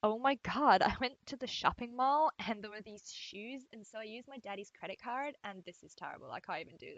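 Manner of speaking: exaggerated American Valley Girl, pitch swooping up; hammed-up Valley Girl gushing; Valley Girl accent. Shown as a demonstration. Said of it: "Valley Girl".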